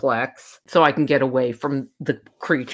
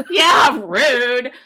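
loud laughing yelp, much higher; laughing loudly while agreeing with her friend; 8 semitones higher, 6 dB louder than usual. Real, not acted. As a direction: blurt it through a burst of laughter. Laughing hard at her own story.

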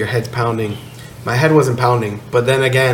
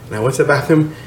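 ordinary talk

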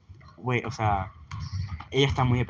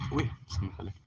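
soft hiccupy aside; with hiccups; 7 semitones lower, 12 dB quieter than usual. Real, not acted. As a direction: hiccup slipping out. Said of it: "tengo hipo de tanto hablar".

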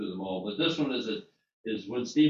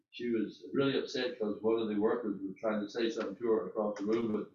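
normal talking voice

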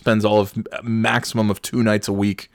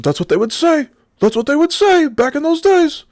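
high, rising rant of the professor; mock yelling; 5 dB louder than usual. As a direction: build to a loud imitated shriek. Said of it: "start yelling".

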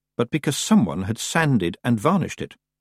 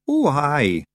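mildly nervous Scots, higher; 6 semitones higher than usual. A narrator's reading. Feegle cornered by Tiffany's question, mildly nervous. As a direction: hedge uneasily as a little Feegle. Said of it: "said the Feegle nervously".

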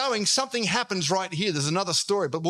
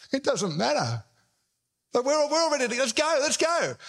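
higher-pitched impatient crowd urging on; eager crowd; 5 semitones higher than usual. Acted. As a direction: brush it off and push to get moving.

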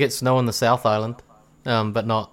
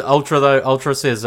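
ordinary delivery

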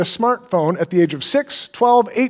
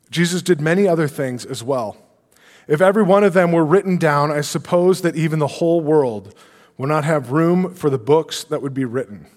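lower, measured Bible reading voice; scripture reading; 5 semitones lower than usual. Acted. Pastor reading John's gospel to the congregation, measured scripture reading. Reads a Bible verse aloud.

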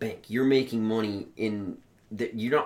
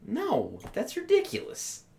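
incredulous; slower than usual. Real, not acted. Reacting to buffet date story.

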